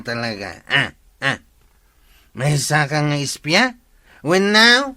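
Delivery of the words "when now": sharp, higher and louder demand; angry shout. Acted. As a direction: bark the question at him. Heated confrontation in village.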